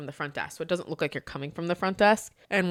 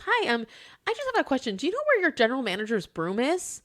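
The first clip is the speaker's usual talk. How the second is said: higher, sweet fake-innocent voice; hint of a playful old man, higher; joking guest; 7 semitones higher than usual. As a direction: lightly play a teasing old joker. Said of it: "and he goes".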